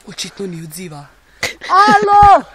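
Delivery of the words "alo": long, high, very loud holler; calling out. Acted. Lost survival host calling for help, loud holler. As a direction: holler it far across the land.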